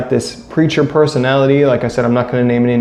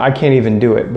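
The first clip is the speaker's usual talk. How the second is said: normal talking voice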